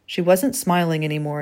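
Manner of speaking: ordinary delivery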